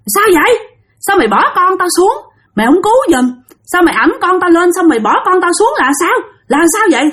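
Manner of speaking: high-pitched reproachful scolding; scolding ghost mother. Retold or imitated. Faceless ghost scolds the man for dropping her child, high and reproachful. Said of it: "là chửi anh".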